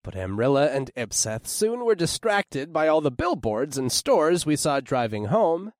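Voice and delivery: ordinary delivery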